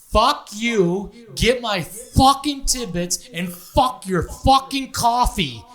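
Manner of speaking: loud, slow furious customer impression; high, loud retold outburst; imitated angry berating. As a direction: act out the customer's tirade, drawing out the curses. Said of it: "after he berates the poor girl".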